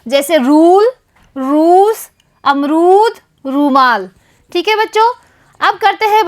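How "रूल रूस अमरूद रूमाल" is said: रूल रूस अमरूद रूमाल with a long, firm रू; stressed long u. Shown as a demonstration. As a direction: lean on every long रू. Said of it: "इस पे अधिक जोर देके यह शब्द बोला जाता है". (हर शब्द में रू पर ज़ोर देकर लंबा)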